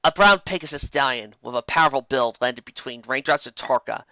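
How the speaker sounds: ordinary delivery, nothing marked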